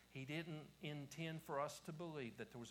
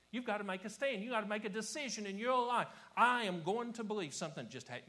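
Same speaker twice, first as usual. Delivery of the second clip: loud, raised call to decide; urgent preaching; 8 semitones higher, 11 dB louder than usual. Acted. Preacher urging listeners to make a stand, urgent loud preaching. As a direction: press the congregation hard, voice up.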